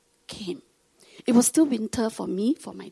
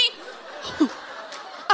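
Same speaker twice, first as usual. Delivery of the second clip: laugh; 6 semitones higher, 7 dB quieter than usual. Real, not acted. Laugh after mom's boast.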